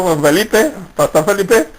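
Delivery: ordinary delivery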